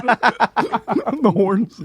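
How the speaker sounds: words lost in laughter; laughing. Real, not acted. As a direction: crack up mid-sentence. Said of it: "laughing fit".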